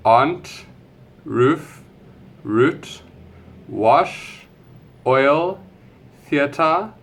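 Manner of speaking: each word read out, rising list tune; careful accent-tag word list, every word rising at the end. Shown as a demonstration.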